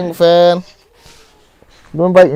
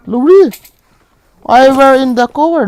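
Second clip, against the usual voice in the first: loud call; 10 semitones higher, 6 dB louder, slower than usual. Real, not acted. Calling teammate during fight.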